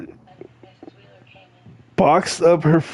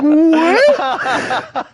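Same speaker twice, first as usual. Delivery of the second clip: high, laughing what; mock baffled drunk, laughing high; laughing disbelief; 14 semitones higher than usual. Retelling being yelled at.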